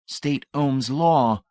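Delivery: very slow, deliberate "State Ohm's law"; slow repeat. Acted. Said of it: "Brady repeated".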